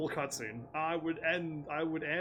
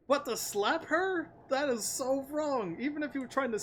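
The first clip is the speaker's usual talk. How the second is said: outraged; 10 semitones higher, 4 dB louder than usual. Real, not acted. Outraged at the slap choice.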